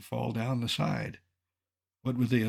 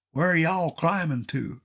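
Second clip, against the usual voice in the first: put-on Texas accent; 5 semitones higher than usual. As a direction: lightly put on Texas tourist asking. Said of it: "in a Texas accent".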